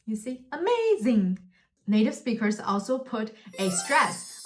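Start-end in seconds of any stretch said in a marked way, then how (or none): 0.5-1.4 "amazing" arching up high on MAZ, then falling; rise-fall intonation. Shown as a demonstration. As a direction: swoop AMAZING up and down. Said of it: "rising and falling intonation".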